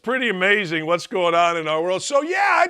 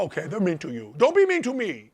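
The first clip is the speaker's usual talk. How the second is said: mock sympathy; faster than usual. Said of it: "And I go".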